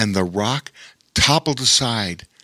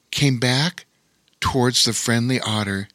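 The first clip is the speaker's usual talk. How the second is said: ordinary delivery, nothing marked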